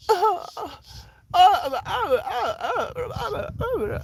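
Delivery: high-pitched frantic babbling "ah"s; mock panicked wailing. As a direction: imitate someone freaking out, very high. Retelling someone panicking over a moment.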